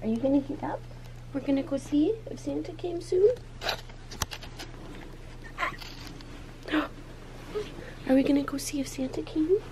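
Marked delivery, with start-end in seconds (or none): 3.3-8.0 sharp breathy gasp of delight; excited gasp. Real, not acted. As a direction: gasp like you spotted presents. Christmas morning excitement.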